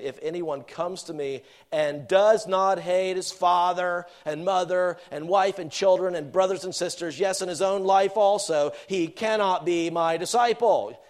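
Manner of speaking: loud, deliberate preacher's reading; forceful scripture reading. Acted. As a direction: proclaim each item of the list firmly. Reads the verse at full force.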